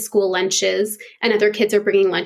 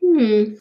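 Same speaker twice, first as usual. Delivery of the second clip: thinking hmm. Real, not acted. Answering a question, pondering.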